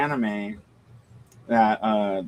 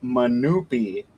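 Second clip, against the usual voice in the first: ordinary talk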